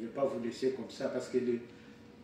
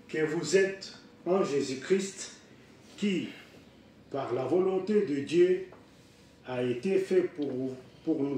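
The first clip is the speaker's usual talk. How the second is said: loud, raised, measured Bible reading; scripture reading; 4 semitones higher, 6 dB louder, slower than usual. Real, not acted. Preacher reads Corinthians to the congregation, loud and declaimed. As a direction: proclaim the verse phrase by phrase, with pauses.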